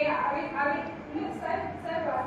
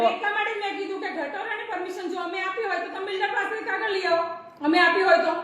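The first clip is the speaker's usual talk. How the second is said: heated laughing retort; 5 semitones higher, 7 dB louder than usual. Real, not acted. Heated argument with villager.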